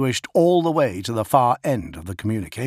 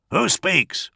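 high, sharp challenge; demanding; 9 semitones higher than usual. A narrator's reading. First Master challenging the voice on the Vox, demanding. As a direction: demand an answer, pitch leaping up. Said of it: "Augustin demanded".

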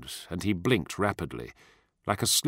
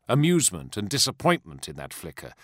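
plain reading voice

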